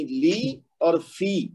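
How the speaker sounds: "لی" and "فی" drawn out with ee; correct vowel demo. Shown as a demonstration. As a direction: slowly model لی and فی, ee not ay. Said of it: "لے ای لافے نہیں کہنا".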